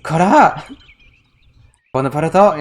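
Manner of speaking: a mock scolding shout; hammed-up angry call of the name; imitating an angry scold. Streamer imitates the girl scolding Bonaparte, mock angry. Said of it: "she sounds madder in Japanese".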